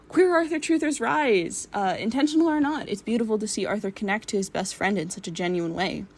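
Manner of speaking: ordinary delivery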